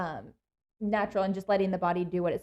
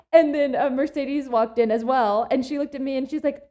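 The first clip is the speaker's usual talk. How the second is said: ordinary talk, nothing marked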